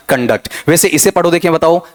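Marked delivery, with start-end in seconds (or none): 0.1-0.5 "कंडक्ट" as kun-DAKT, stress on the end; weak kun, then firm DAKT in "कंडक्ट"; verb pronunciation. Shown as a demonstration. Teacher repeating the verb reading, verb pronunciation. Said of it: "इसे verb मानते हुए पढ़ा".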